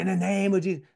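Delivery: high-pitched mock prayer command. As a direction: raise the voice as if rebuking in prayer. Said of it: "without raising my voice, without saying".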